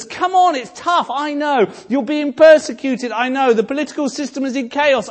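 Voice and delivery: high, loud, rousing call urging the church on; cheering crowd of witnesses. Pretend. The great cloud of witnesses cheering the church on, high and loud. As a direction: shout encouragement like a heavenly crowd.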